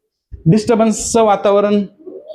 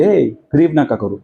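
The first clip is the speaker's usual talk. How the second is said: lower, slowed, firm hush to the congregation; Kathryn Kuhlman rebuking the crowd; 9 semitones lower than usual. Retold or imitated. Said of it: "ती लगेच लोकांना म्हणली".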